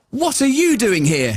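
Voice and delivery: put-on a small boy, baffled; boy confronting a stranger, puzzled; confused child. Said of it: "slightly confused by the whole thing".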